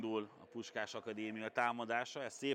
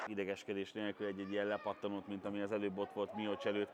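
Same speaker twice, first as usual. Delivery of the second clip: normal talking voice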